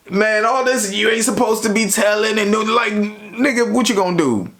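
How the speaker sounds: high-pitched indignant challenge, imitated; retold heated confrontation. Retold confrontation over being reported.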